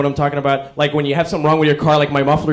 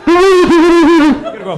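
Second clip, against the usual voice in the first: slow, high, loud drawl; 13 semitones higher, 7 dB louder, much slower than usual. Acted. Comic build-up.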